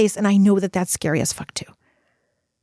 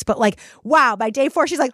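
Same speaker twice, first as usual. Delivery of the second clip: normal talking voice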